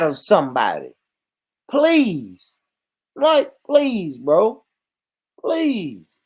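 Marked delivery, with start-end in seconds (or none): 1.7-6.2 mock pleading. Begging UK fans mockingly.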